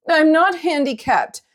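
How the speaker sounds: high, slow, indignant outburst; indignant shout. A narrator's reading. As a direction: protest loudly, offended. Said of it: "Dewey shouted".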